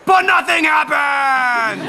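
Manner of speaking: loud, slow, high exclamation; dramatic shout. A narrator's reading. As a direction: proclaim the disappointment theatrically. Dramatic twist.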